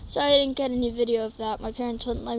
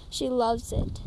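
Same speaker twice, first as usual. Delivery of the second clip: usual speaking voice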